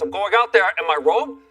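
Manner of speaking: a grumpy grandpa refusing to dress, mimicking Bernie; cranky old man in his bathrobe; stubborn grandpa. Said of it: "Grandpa, at least put on some pants".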